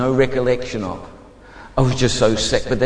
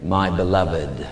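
low, slow divine voice; voice of God; 10 semitones lower, slower than usual. Retold or imitated. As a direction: speak low and unhurried as God to a beloved. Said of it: "A voice had just simply said".

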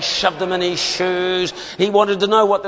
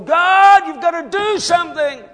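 a sudden pleading shout to heaven; a raw cry for help flung upward; desperate heart cry; 13 semitones higher, 5 dB louder than usual. Pretend. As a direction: cry out to God, much higher and louder. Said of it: "one more heart cry".

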